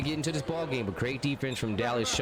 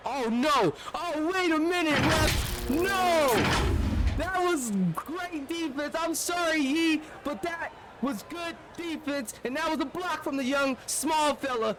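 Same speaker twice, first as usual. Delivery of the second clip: high, worked-up objection to the ref's whistle; excited protest; 12 semitones higher than usual. Real, not acted. Commentator arguing with the ref over a block, high and excited. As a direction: pitch it up high and argue the call.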